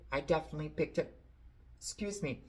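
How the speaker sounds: quick words while choking on saliva. Real, not acted. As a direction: rush it out as saliva catches in the throat. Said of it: "choking on my own saliva".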